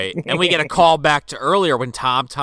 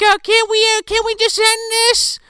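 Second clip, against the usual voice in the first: very high, put-on damsel voice; mock pleading damsel. Bo Peep begs villain to end it, mock pleading.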